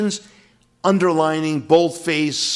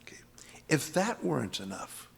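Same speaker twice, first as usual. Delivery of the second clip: whisper; 10 dB quieter than usual. Real, not acted. Teacher building suspense over verse.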